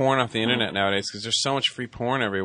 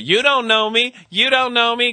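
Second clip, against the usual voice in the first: high, loud mock confrontation; hammed-up defiant guest, pitched way up; talk-show guest imitation; 11 semitones higher, 4 dB louder, slower than usual. Segueing back into the Jerry Springer bit, mock-defiant shouting. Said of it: "I'll segue back into it".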